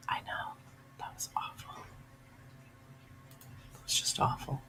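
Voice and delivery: soft, whispered disgust. Real, not acted. A streamer reacts to the cruel mother, whispering in disgust. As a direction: barely breathe the words out.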